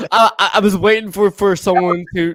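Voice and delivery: talking through real laughter; laughing. Real, not acted. Co-host owning up to waiting for the joke, laughing. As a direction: get it out while cracking up, pitched high and loud.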